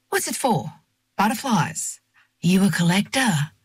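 put-on old guy quizzing a customer, pitch swooping; old shop man. A narrator's reading. Old shopkeeper asks what the net is for, nosy old guy's voice. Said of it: "says the old guy behind the counter".

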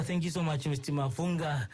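ordinary delivery

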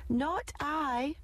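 high quacky duck voice; high quacked duck reply; quacking duck. A narrator's reading. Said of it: "quacked the duck".

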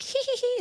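very high-pitched mischievous tittering; squeaky put-on snicker; mock prank giggle. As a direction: imitate a kid giggling after a prank call. Mimics prank callers giggling.

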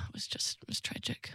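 breathy mock-tragic whisper; whispering it was tragic, half joking. Real, not acted. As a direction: drop to a hushed whisper for the sad bit. Mock-tragic aside about lost phone.

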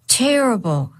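usual speaking voice

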